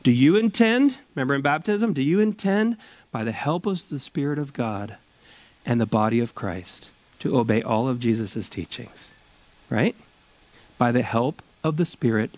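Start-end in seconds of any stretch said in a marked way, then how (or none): none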